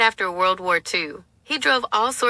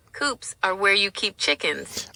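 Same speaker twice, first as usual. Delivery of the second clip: normal talking voice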